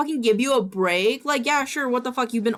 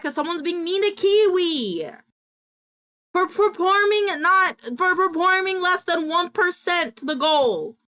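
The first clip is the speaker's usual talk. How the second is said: high-pitched indignant outburst; worked-up protest on a friend's behalf; mad; 10 semitones higher than usual. Real, not acted. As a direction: whine in outrage, pitch way up. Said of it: "Ooh, I'm mad".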